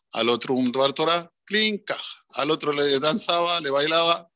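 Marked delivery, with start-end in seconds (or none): 1.5-2.2 high chirpy ding sound, played for a laugh; mock cash-register ding. Donor drops the money into the till, imitated ding. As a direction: chime it like a till taking money.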